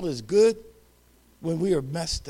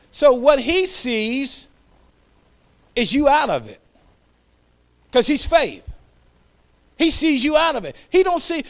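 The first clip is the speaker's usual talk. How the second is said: preaching at full force; 6 semitones higher, 8 dB louder than usual. Acted. Preacher fires up the congregation, full-force preaching. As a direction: build the fire of the sermon.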